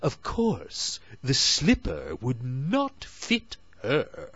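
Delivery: high stepsister voice, slowing down; elder stepsister voice. Acted. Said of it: "said the elder sister".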